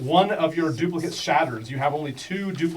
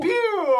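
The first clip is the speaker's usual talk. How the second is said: mock zap; 4 dB louder than usual. Spell sound effect.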